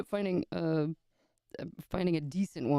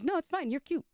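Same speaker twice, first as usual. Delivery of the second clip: playful high mimic; 13 semitones higher than usual. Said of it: "and he was like".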